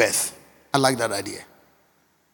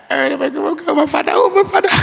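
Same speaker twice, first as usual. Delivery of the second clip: anguished cry; 6 semitones higher than usual. Real, not acted. Preacher lamenting his absent father, rising pained cry.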